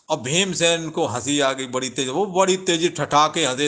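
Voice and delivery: ordinary talk, nothing marked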